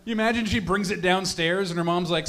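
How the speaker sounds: normal talking voice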